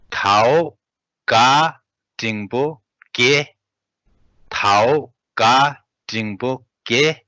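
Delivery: careful teacher reading; slow Tibetan model reading. Shown as a demonstration. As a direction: read the Tibetan phrase slowly, word by word. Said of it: "And then next".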